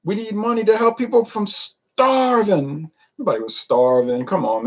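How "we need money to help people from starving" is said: much higher, slow mock alarm; mock hysteria. Mocking old-time hysteria to Ron, high slow parody voice. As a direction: ham up the panicked plea for money. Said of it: "A lot of hysteria".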